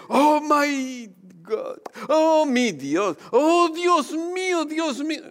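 high, drawn-out exclamations of shock; hammed-up astonishment, stretch each "oh"; mock surprise. Preacher showing God's name misused as an exclamation, mock surprise. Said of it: "una expresión de sorpresa".

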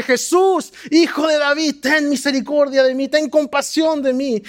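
high shouted plea; pleading shout. Retold or imitated. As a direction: shout over the crowd's hushing. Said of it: "pero él gritaba más fuerte".

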